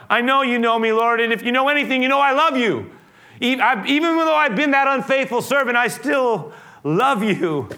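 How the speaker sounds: preached heartfelt plea; pleading Peter. Acted. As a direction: beg to be believed, building.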